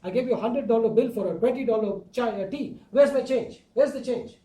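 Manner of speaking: hammed-up indignation at the waiter; upset demand. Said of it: "He gets so upset with the waiter".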